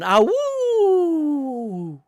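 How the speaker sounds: long, high, loud mock howl sliding down; wolf howl. Failing to put twang on a howl, mock howling. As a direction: howl high and let it fall away. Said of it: "can't figure out how to do a twang".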